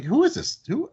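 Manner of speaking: sharp high puzzled question; baffled asking. Retold or imitated. Boss hearing about a constant complainer, baffled high question. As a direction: ask who this is, taken aback. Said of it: "I'm like".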